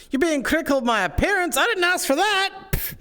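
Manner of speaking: very high, squeaky protest; mock indignation. Objecting to criticism of their appearance, high-pitched mock outrage. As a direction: squawk it in offended falsetto. Teased about looks, mock-offended outburst.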